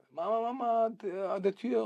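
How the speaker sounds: viel höher, ein Kind ruft aufgeregt; Kinderstimme. Retold or imitated. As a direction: ruf wie ein kleines Kind nach Mama. Kind ruft drinnen nach Mutter. (Kind ruft hoch nach Mama)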